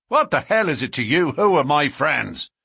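loud, fast, angry roar. A narrator's reading. McMurdo roars at the policeman who warned him, loud and fast. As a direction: roar it back at the meddling policeman. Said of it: "roared McMurdo".

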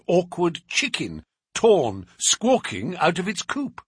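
plain reading voice

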